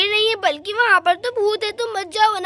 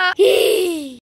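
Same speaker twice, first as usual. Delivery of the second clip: spooky laugh; 4 dB louder than usual. Acted. Ghost laugh in the dark.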